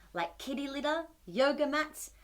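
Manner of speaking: usual speaking voice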